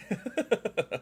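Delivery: high giggle; laugh. Real, not acted. Streamer amused by their own silly remark, laugh. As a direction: let out a real, high-pitched chuckle.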